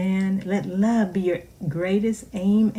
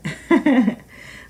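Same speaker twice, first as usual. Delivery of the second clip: laugh; 4 semitones higher, 6 dB louder than usual. Real, not acted. Laughs after her story.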